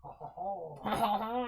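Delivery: theatrical French chuckle; French-accented laugh. Acted. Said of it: "I gotta bring back my French voice".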